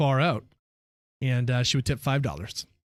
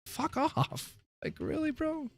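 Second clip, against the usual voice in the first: high-pitched incredulous whine, trailing softer; mock disbelief; 8 semitones higher, 5 dB quieter, slower than usual. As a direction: act stunned by a tiny tip. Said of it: "I'm like".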